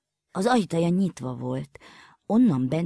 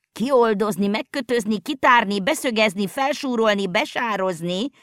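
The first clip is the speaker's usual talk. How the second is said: shouting wildly; 5 semitones higher, 3 dB louder than usual. A narrator's reading. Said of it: "kiáltozott bolondul Vacska Mati".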